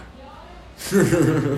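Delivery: breathy high giggle; stoned giggle. Acted. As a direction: giggle mid-sentence. Laughs within acted quote.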